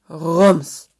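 booming, punched-out impact; one big thudding burst; loud crash word. A narrator's reading. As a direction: blurt it like a sudden collision. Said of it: "als es rumste".

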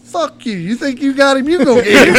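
high, incredulous retort building loud; retold indignant comeback, breaking into laughter; defiant refusal. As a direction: throw the order back at him.

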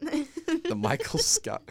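high, slow, pompous announcer; grand mock announcement. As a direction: unveil the name like a plaque. Mock naming a room after him.

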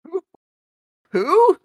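a sudden high, loud "Who?"; mock outrage. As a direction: yelp it in fake indignation. Mock outrage at the time check.

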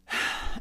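long wordless breath out; heavy sigh. Retold or imitated. Mother recalls scaring her little girl, regretful sigh.

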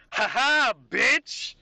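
loud, high taunting laugh at a loser; gloating barkeep. Acted. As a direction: crow over the fallen drunk. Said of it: "and then the barkeep goes".

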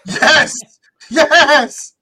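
loud, high celebratory cheer; retold hyped-up whoop; excited. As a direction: cheer it on like a thrilled fan. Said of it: "I was excited".

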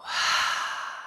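slow open-mouthed exhale sigh; long release sigh. Acted. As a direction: let the breath go audibly. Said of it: "Open the mouth, let it go".

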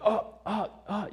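high, loud startled stammer; caught off guard. Retold or imitated. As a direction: imitate someone busted while hiding. Imitates man caught lurking.

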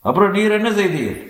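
high, insistent probing question; ominous tone. A narrator's reading. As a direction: press for the answer with menace. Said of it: "அதே விபரீத துணியுடன்".

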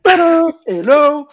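high, loud fanfare cry of Tadam; a joyful trumpet-call Tadam; mock fanfare. Unveiling the guest, fanfare.

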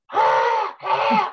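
squealing alarm, pitch way up; high panicked kids' cry; frantic high shriek. Retold or imitated. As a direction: shriek a warning at him. Kids shouting warning at Dad.

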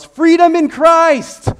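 drawn-out, high full-throated yell; yelled slogan. Retold or imitated. Friend yelling his slogan while running the stop sign, a high drawn-out roar. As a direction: belt it out like a reckless battle cry. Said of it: "And he yells".

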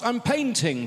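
put-on high cheery child shout. Toddler calling from the hallway, shouting happily. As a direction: shout it like a toddler proud of mischief. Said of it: "someone ominously shouts".

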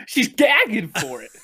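high giggly voice; barely getting it out through laughter; laughing. Real, not acted. Giggling at innuendo joke.

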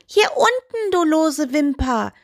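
high, impudent toe voice calling out; cheeky. A narrator's reading. Toe calls up to the doll from below, cheeky. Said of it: "rief der Rausguckzeh frech".

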